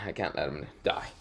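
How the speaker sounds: usual speaking voice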